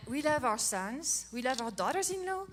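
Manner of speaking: ordinary talk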